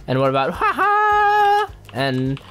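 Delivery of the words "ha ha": drawn-out high mock laugh; high showy ha-ha. Gamer showing off practised dance moves, high crowing. Sound effect for dance move.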